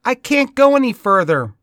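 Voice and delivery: put-on high, loud child's call; little boy calling out. A narrator's reading. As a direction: call after the toad as a small boy. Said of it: "he called out".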